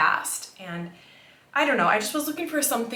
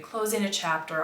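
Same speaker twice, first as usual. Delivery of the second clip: ordinary delivery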